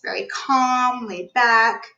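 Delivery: slow and laid back; calm, laid back. Shown as a demonstration. Coach showing how the calm client talks. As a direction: ease right off, slow and calm. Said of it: "they're talking like this".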